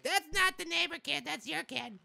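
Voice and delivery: high, squeaky mocking voice; put-on high nitpicker voice; whiny commenter imitation. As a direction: mimic nagging viewers in a high squeal. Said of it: "you sound like that when you say it".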